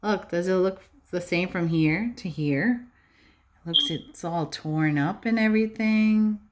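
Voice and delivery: ordinary talk, nothing marked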